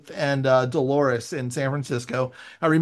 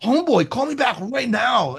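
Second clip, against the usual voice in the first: mock-urgent, higher-pitched summons; retold urgent demand; 8 semitones higher, 4 dB louder than usual. Joe demanding a callback from a nervous writer, mock urgent. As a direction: imitate an urgent phone message, pitched high.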